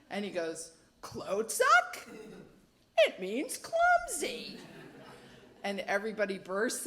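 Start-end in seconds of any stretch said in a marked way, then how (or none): 1.0-4.6 high, loud, slow and cheerful answer; innocent brother. Retold or imitated. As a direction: answer happily, clueless. Brother answers innocently, everyone watching.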